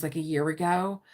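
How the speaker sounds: normal talking voice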